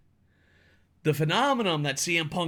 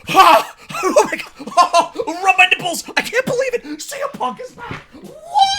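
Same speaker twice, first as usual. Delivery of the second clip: shrieking, laughing fanboy much higher and louder; mock overexcited fan; 8 dB louder than usual. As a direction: ham up a fan losing it over a return. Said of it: "You gotta be like this".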